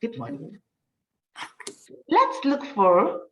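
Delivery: ordinary talk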